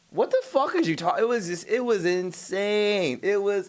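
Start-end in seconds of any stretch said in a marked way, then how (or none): 0.1-1.2 high-pitched disbelief; squeaky, outraged question; high incredulous. Retold or imitated. Exasperated retort in retold argument.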